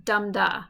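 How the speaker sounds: "dum da", strong "dum" then light "da"; stress on DUM. Shown as a demonstration. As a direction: lean on "dum" and let "da" drop. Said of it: "stressed syllable followed by an".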